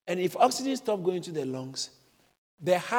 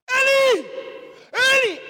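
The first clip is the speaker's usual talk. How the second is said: anguished shout; 9 dB louder than usual. Retold or imitated. Preacher re-enacting Jesus calling out on the cross, loud anguished shout. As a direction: cry out the name as if dying on the cross. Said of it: "He shouted".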